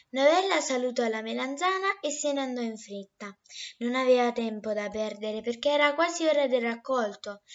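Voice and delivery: plain reading voice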